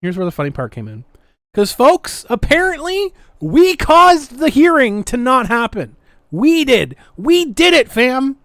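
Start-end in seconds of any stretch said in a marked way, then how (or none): 1.5-8.4 high, loud mock celebration, pretending they won; sarcastic mock triumph. To the audience, sarcastically announcing their supposed win, mock triumphant. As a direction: crow over a fake victory, dripping sarcasm.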